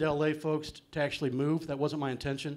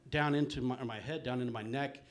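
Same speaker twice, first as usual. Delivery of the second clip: ordinary delivery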